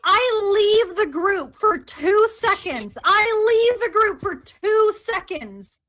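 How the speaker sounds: loud, whiny disbelief; exasperated outburst. Real, not acted. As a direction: vent your frustration loudly. Exasperated after a kill.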